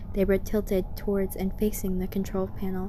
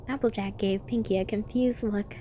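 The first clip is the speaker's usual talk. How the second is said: ordinary delivery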